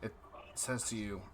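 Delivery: ordinary delivery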